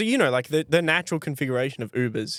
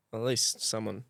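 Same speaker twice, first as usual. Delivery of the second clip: soft, low, wounded mumble; sad; 5 semitones lower, 6 dB quieter, slower than usual. Real, not acted. Prankster consoling himself about the joke, sadly. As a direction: let it trail off quietly, a bit sorry for yourself. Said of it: "That sounded so sad".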